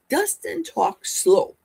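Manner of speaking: slow talking. Shown as a demonstration. Describing slow talker by slowing down.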